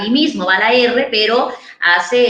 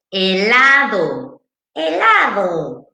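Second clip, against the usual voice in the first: put-on slow, drawn-out helado, a little girl's voice; child's helado; much slower than usual. Therapist imitates the girl proudly saying helado. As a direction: helado stretched slowly, childlike and whiny. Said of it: "A ver, otra vez".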